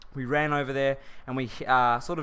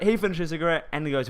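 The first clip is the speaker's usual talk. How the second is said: normal talking voice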